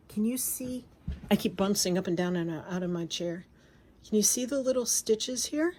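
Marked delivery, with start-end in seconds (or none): 0.1-0.8 soft whispered question. Real, not acted. Holding fabric to the camera, whispering to viewers. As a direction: whisper it as if sharing a secret. Showing stitches up close to camera.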